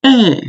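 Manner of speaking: eh falling sharply from high; fourth tone eh. Shown as a demonstration. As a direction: drop eh hard from the top. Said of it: "I'm just gonna repeat it again".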